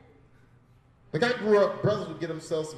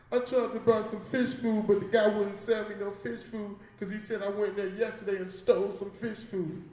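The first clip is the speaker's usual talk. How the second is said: put-on childlike whine; 6 semitones higher, 3 dB louder than usual. Child self voiced plaintively.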